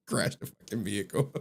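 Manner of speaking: ordinary talk, nothing marked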